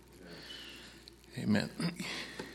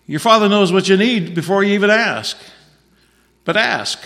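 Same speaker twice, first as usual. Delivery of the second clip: raised, louder preaching voice; preacher raising voice on Jesus' promise; forceful preaching; 7 semitones higher, 7 dB louder than usual. Acted. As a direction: drive it home to the congregation.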